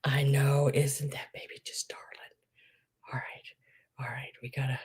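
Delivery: soft whisper. Real, not acted. Aside while showing baby photo.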